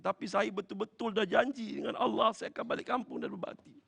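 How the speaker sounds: high, choked-up thanks; tearful gratitude. Retold or imitated. Bereaved son thanks the preacher for waking him, tearfully. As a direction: pour out thanks through acted tears.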